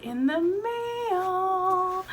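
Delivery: high, drawn-out singsong; slow, gleeful lilt climbing high; excited singsong. Real, not acted. Showing off newly arrived package.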